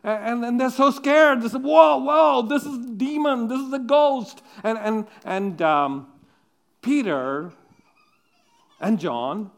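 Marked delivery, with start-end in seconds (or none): 1.6-4.4 mock frightened cry. Disciples voiced scared of ghost.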